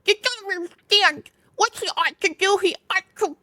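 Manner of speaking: high, insistent animal voice; insist, pitched high; arguing Mole. Acted. Mole arguing with Rat, high insistent voice.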